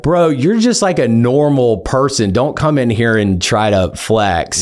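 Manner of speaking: high, dismissive scoff; scoffing callout. Retold or imitated. Lawyer scoffing at the bragging opposing counsel. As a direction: tell him off for showing off.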